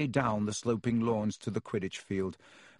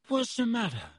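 groggy; 12 semitones higher than usual. A narrator's reading. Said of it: "said Harry groggily".

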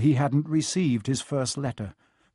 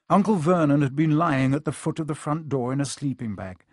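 plain reading voice

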